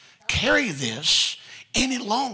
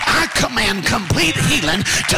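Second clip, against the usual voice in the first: a louder and louder shouted healing prayer, ending much higher; preaching at full force; 4 semitones higher, 6 dB louder, slower than usual. Acted. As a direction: command the sickness out, building to a shout. Prays commands for healing.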